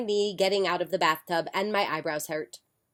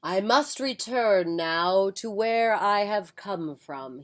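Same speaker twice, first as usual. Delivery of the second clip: slow, solemn farewell; slow old wizard; slower than usual. Acted. Wizard taking his leave from the mother, slow and solemn. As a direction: fade out on the last word.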